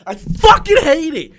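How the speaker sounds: loud, exasperated burst of hate; furious outburst. Real, not acted. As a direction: spit it out, really fed up. Angry rant about the movie.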